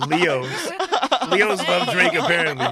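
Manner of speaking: loud, laughing remark; amused. Real, not acted. Podcast host teasing fellow [name] fans, amused and laughing. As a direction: say it loudly over shared laughter.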